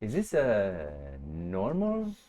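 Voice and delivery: put-on low, slow, restrained Scandinavian accent; toned-down Swedish accent. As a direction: dial the mock-Swedish back to half, drawing it out. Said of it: "Now turn it down to, like, one half".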